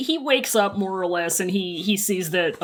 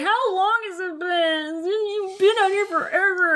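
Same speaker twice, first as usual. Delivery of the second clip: high nagging; 8 semitones higher than usual. Acted. Wei Wuxian nagging.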